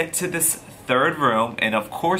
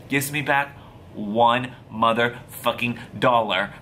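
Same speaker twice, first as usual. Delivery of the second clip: plain reading voice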